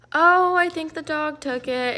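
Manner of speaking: high, slow, drawn-out innocent lie; feigned innocence. Retold or imitated. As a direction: play innocent, stretching it out sweetly high. Lying innocently to suspicious dad.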